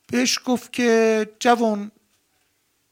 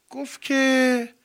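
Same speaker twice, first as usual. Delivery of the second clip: normal talking voice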